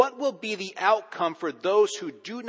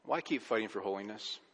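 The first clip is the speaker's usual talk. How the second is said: hushed sermon reflection; 3 semitones lower, 7 dB quieter than usual. Acted. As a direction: draw them in quietly, almost intimate. Quiet reflective sermon passage.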